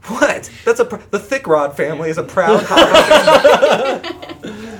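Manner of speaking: high, loud indignant hobbit protest; hammed-up hobbit pride, laughing through it; offended hobbit voice. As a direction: puff up proudly for the family name. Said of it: "And then I was like".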